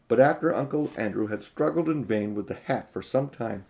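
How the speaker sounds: usual speaking voice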